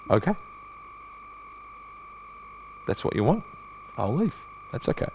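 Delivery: soft, quiet, unbothered Jesus; gentle acceptance. Acted. Jesus quietly accepts being sent away, gently. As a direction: let it go without any hurt. Jesus answers those rejecting him.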